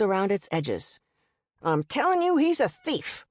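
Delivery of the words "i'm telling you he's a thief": angry guest yelling at the motel owner; yelling accusation. A narrator's reading. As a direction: yell it at her like he's been robbed. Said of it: "The man was yelling at Mama".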